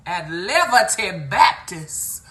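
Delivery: loud, emphatic mock preacher with "livity" and "miniscree"; imitating church speaker. Church man declaring the ministry, loud mispronouncing imitation. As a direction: proclaim it like a preacher mangling his words. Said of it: "and he said".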